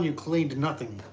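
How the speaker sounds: ordinary delivery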